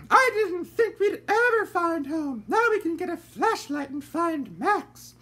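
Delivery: put-on high, slow, prim old lady voice; a genteel lady fretting in the dark; voiced lady character; fussy lady. A narrator's reading. Lady Dandelion voiced again.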